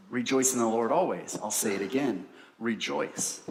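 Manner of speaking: softened, falling, solemn repeat of Rejoice; emphasis. Shown as a demonstration. Preacher reads the doubled verse to the congregation, low and solemn. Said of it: "So there is emphasis on it".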